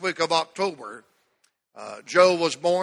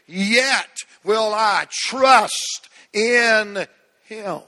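preached declaration; 3 semitones higher, 6 dB louder, much slower than usual. Acted. Declares Job verse to church.

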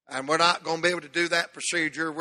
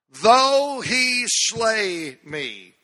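drawn out very slow, full preaching cadence; preached verse; 4 semitones higher, 5 dB louder, much slower than usual. Acted. Pastor to his church, slow solemn preaching. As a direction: stretch every word like a revival preacher.

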